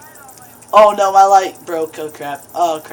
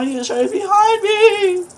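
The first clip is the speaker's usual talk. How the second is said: much higher, half-crying alarm; terrified cry; 12 semitones higher than usual. Real, not acted. Player spots the monster behind him, terrified cry. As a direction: cry out that it's right there.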